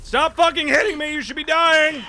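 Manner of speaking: high, loud exasperated yell; frustrated shouting. Real, not acted. Enemy hits player in game.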